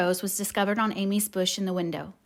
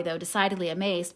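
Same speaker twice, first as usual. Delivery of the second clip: ordinary delivery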